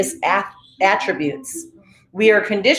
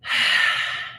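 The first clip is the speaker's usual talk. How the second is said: long breathy sigh out; audible exhale; 5 dB quieter than usual. Shown as a demonstration.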